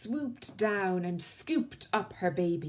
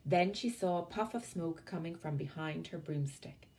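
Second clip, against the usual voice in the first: usual speaking voice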